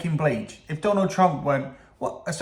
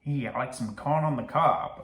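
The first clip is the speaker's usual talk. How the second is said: amateur mock American accent; badly faked American voice; attempted American accent; 4 dB quieter than usual. Shown as a demonstration. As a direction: try out a rough American accent. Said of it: "attempt an American accent".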